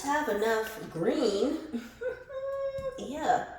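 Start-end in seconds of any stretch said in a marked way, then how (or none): 1.6-2.9 high squealing laugh. Real, not acted. Shocked at designer baby.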